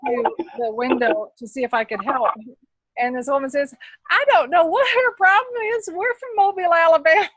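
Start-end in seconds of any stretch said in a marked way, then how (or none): 4.1-7.3 high, loud Alabama Southern drawl. Retold or imitated. Woman from Mobile answering the aunt, in a thick Southern drawl. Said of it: "she had the strongest drawl".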